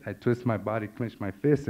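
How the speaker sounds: ordinary talk, nothing marked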